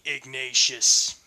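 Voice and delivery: loud, slow full name; sharp full name. Acted. Eugene naming Ignatius in rebuke, loud. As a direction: bark the name to cut him off.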